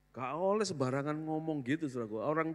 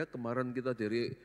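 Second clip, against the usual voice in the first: normal talking voice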